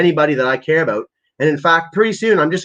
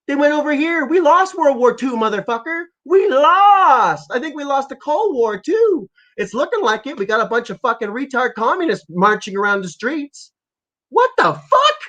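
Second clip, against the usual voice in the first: getting fired up; 9 semitones higher than usual. Real, not acted. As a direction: voice climbing much higher, heated rant. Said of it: "I'm getting fired up here".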